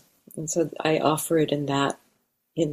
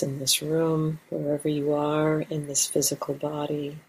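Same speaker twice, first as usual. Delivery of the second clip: calm, unhurried meditation guidance with long pauses; guided meditation. Real, not acted. Teacher guiding meditators to arrive in the body, guided meditation. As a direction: settle listeners gently, leaving space between phrases.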